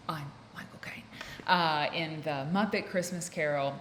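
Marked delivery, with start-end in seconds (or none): 0.1-1.0 whispered mock Michael Caine line. Vlogger whispering a joking Michael Caine impression. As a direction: breathe it like a secret Caine impression.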